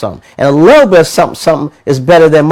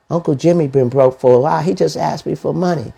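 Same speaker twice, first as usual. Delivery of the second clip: normal talking voice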